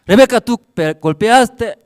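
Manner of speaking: loud, high mock accusation; retold scolding. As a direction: shout down a naughty child, acted out. Acted father confronting Rebeca.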